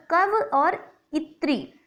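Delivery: "कव और इत्री" spelled out slowly, syllable by syllable; teacher slowly splitting the word कवइत्री into parts; split into syllables. Shown as a demonstration. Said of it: "बल्कि कवइत्री बनता है".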